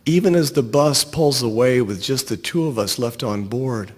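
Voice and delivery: usual speaking voice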